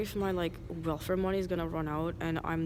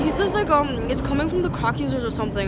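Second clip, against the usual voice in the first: high, loud and worked up; really freaking out, voice climbing; agitated; 6 semitones higher, 9 dB louder than usual. Real, not acted. Ranting to the camera about disease, loud and high.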